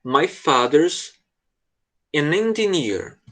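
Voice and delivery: very slow, careful teacher read; very slow model line. Shown as a demonstration. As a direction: spell it out slowly for the student. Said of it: "A próxima fala diz assim". (leitura bem lenta e pausada)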